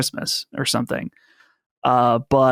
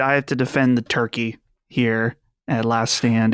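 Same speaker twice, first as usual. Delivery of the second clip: normal talking voice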